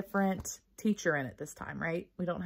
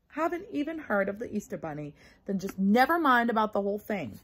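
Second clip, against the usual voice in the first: mildly huffy; 7 semitones higher, 5 dB louder than usual. Acted. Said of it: "waved them around real angry".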